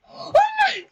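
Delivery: very high squeaky gasp; shocked squeal. Real, not acted. Vlogger squealing when she sees the result.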